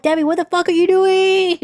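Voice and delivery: alarmed inner voice. Retold or imitated. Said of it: "voice in my head".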